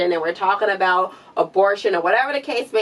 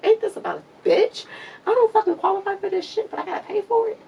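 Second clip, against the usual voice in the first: much higher, incredulous voice of her own thoughts; mock indignant self-quote; 13 semitones higher than usual. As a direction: play your own exasperated inner voice, pitched way up. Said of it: "I'm just sitting here like".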